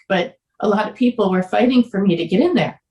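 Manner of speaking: usual speaking voice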